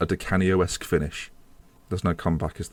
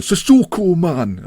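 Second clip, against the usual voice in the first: loud high Newcastle cry of "Sissoko, man"; Geordie shout; 10 semitones higher, 8 dB louder, much slower than usual. Retold or imitated. Fan mocking the Newcastle player, loud Geordie shout. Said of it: "He'll be going".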